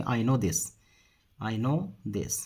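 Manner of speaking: slow, word by word; measured dictation-style delivery; slow model sentence. Shown as a demonstration. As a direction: say it slowly for learners, spacing each word. Teacher repeats sentence slowly for learners.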